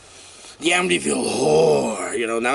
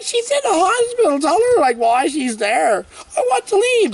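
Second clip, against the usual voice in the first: very high, louder mock protest; upset girl; 3 dB louder than usual. Heroine pleading with doctor to leave, high whiny protest. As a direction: whine it like a trapped patient.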